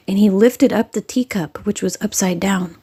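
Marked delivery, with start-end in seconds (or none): none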